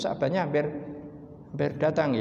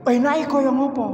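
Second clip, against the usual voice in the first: high, louder put-on voice pressing for more; acted host prodding; 10 semitones higher, 4 dB louder than usual. Host pressing the guest, high and eager. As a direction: push the guest to explain. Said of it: "Njur kon crita".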